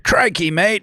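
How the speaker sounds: put-on Australian accent. Friend showing his fallback accent, exaggerated Australian. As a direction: exclaim it as a cartoon Australian. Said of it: "I always go fucking Australian".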